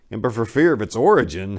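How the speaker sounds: plain reading voice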